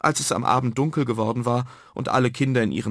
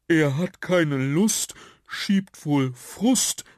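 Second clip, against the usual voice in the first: higher, slow put-on creature voice; storybook sprite, slow and pitched up; little blue dot; 5 semitones higher, much slower than usual. A narrator's reading. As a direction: draw the rhyme out in a small high voice. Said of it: "wisperte ein blauer Punkt".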